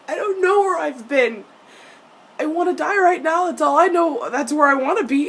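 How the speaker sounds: high, loud mock wail; overwrought fan reply. Fan answering the character, melodramatic high wail. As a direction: wail it in hammed-up despair.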